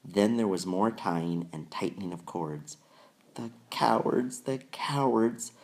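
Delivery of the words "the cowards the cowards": high tearful mock sob; sobbing. A narrator's reading. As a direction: weep the words out. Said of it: "sobbed Susan".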